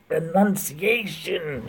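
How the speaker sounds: enunciation stretched slow and high, every syllable crisp; over-enunciated. Shown as a demonstration. Streamer showing how Hamill enunciates, slow and high. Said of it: "he does every single en".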